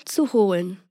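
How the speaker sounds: ordinary delivery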